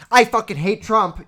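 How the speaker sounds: loud, high blurted rant; aggressive outburst. Retold or imitated. Blurting politics at someone just met, loud aggressive shout. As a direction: spit it out at a stranger with force. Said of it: "that's a little aggressive".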